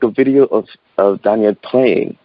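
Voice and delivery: a hint of the uncle's French accent again. Nephew quoting his French uncle about the video in a light French accent. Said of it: "He's like".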